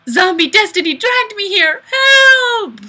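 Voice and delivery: high, loud desperate yell; mock cry for help. Streamer reading a viewer's comment as a panicked cry for help. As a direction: scream for help in fake terror.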